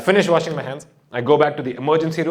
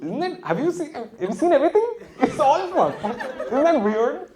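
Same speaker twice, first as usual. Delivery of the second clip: mock puzzled musing; 10 semitones higher than usual.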